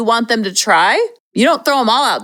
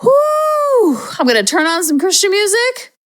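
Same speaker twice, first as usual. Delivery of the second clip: high whooped exhale, then higher-pitched chatter; play-acted self-calming; 5 semitones higher than usual. Parent talking themselves down aloud in the car, play-acted self-calming. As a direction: act out cooling off, light and silly. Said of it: "I'm talking just like that".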